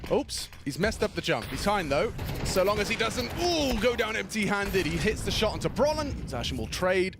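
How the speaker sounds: excited live play-by-play; esports caster. Acted. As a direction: call the round fast and lively.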